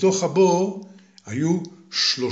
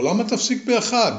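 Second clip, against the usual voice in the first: high, cheeky and eager; 9 semitones higher than usual. Acted. Fearless young man teasing the voice in the chimney, eager. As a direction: tease the voice to keep going. Hero cheerfully urges more.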